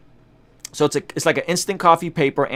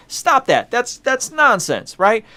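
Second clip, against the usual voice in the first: much higher, slow, scolding mock voice; scolding pastor; 10 semitones higher, slower than usual. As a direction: play the fed-up pastor shutting it down. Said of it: "she was like".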